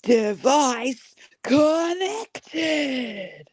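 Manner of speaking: very slow high flat American voice assistant; robotic device voice. Retold or imitated. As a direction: drag out each syllable like a gadget announcement. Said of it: "He says".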